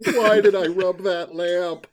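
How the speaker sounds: playful cry of despair; mock anguish. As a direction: lament it like a comic victim, rising to a loud cry. Comic voicing of an imagined sufferer.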